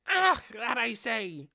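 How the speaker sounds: high, loud, drawn-out cry of amazement; surprised shout. Acted. Hamster amazed her body slam failed, a slow loud surprised cry. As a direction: cry out, stunned he didn't budge. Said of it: "Shouting in surprise".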